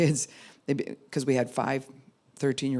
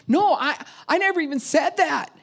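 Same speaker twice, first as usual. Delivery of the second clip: high, loud and panicky; scared kid blurting a denial; fearful denial; 13 semitones higher, 5 dB louder than usual. Retold or imitated. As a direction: plead your innocence in fear. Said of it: "one's posture is absolute fear".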